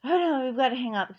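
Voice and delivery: hammed-up hurried official; mocking the officials. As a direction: rattle it off like a dismissive clerk. Mocking the officials brushing her off.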